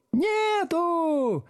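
very high, drawn-out whoop; jubilant yell. A narrator's reading. Back row answering teacher, jubilant high howl. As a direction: howl it in gleeful chorus. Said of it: "ликующе завопила галёрка".

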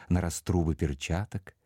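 ordinary delivery, nothing marked

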